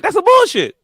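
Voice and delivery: high, loud objection; mock outburst. Calling out nonsense overheard on the phone, high and indignant. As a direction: blurt it out high, cutting in. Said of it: "And you like".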